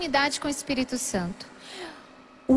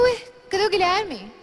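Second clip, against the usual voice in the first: higher, puzzled question; puzzled surprise; 4 semitones higher than usual. Retold or imitated. Woman returns to find her boyfriend gone, puzzled. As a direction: act out being baffled by an absence. Baffled that he has vanished.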